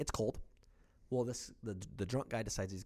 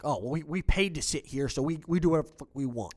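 a touch louder, mock-belligerent tone; belligerent retort; 3 semitones higher, 6 dB louder than usual. Belligerent fan snapping at the brother, mildly mocking. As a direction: sneer it back like the pushy stranger. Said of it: "the guy's belligerent".

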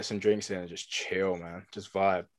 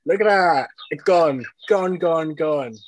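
loud, high, excited cheer; 6 semitones higher, 7 dB louder, slower than usual. Real, not acted. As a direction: cheer him on as the drink vanishes. Excited watching chug clip.

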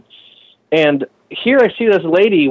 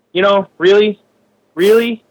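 high, mocking disbelief; incredulous; 7 semitones higher, 4 dB louder than usual. Calling out snobbish frame builders, incredulous. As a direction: challenge them with a louder, higher question.

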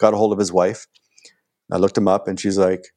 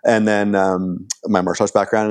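ordinary talk, nothing marked

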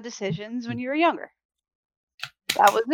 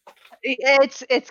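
congested; 3 semitones higher, 3 dB louder, slower than usual. Real, not acted. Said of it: "You have congestion?".

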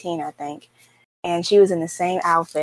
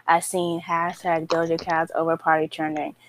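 usual speaking voice

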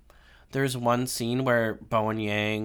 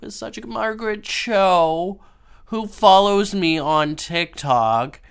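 getting emotional; 4 semitones higher, 6 dB louder than usual. Real, not acted. To viewers, gushing about Margaret Cho, emotional. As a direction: voice welling up with real emotion. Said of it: "I'm getting emotional".

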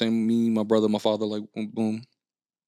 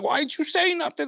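much higher, an exasperated relative's protest; retold outcry. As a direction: cry it out like a disappointed aunt. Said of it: "They was like".

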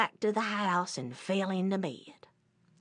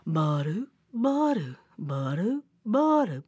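sauntering bear footfalls; 3 semitones lower than usual. Acted. Imitates bear sauntering along.

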